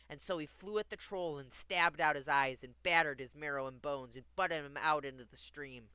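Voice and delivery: firm, forceful storybook narration; emphatic rereading. Acted. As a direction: drive the violent passage home. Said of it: "I'll read that part again".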